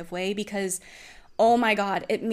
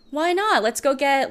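imitating him, higher and louder; mock-eager boyfriend pressing to go eat; pushy partner; 6 semitones higher, 5 dB louder than usual. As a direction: push eagerly, like he won't drop it. Imitating partner pushing for food.

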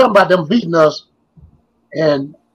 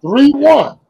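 three one, called out high and loud; proud exclamation; 8 semitones higher, 4 dB louder than usual. Real, not acted. To friends recalling the winning move, proud burst. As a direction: announce the score with pride.

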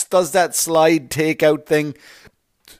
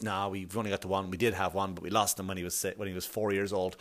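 low, much softer voice; subdued bereaved parent; 8 semitones lower, 12 dB quieter, faster than usual. Acted. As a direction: quietly admit an old loss, acting it. Acted bereaved parent answering small talk.

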